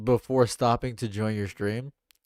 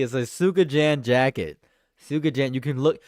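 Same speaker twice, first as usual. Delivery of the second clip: ordinary delivery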